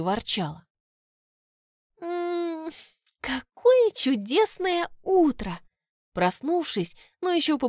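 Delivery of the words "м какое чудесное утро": high, slow blissful fairy sigh; happy sigh. A narrator's reading. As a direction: sigh with morning happiness. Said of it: "счастливо вздохнула фея".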